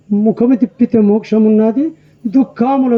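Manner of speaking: spoken, quoted verse line with a falling close; the verse quoted in speech; recited verse. Shown as a demonstration. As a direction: recite the hymn words plainly, as a teacher quoting.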